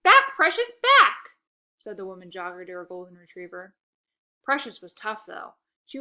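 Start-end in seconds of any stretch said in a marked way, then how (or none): 0.0-1.4 loud, much higher urgent command; shouting at dog. Acted. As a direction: pull the dog back urgently. Owner yelling at dog.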